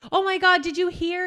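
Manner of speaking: high, loud retold aunt; imitating a thrilled aunt; excited gossip. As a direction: blurt the big news excitedly. Retold aunt breaking the news.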